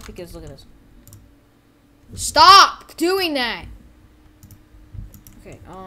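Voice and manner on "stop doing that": loud, high, drawn-out command; exasperated yell. Pretend. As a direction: shout it slowly in annoyance. Scolding the game.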